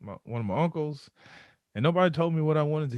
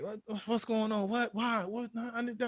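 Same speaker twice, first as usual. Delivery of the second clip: panicked confusion; 10 semitones higher, 6 dB quieter than usual. Retold or imitated.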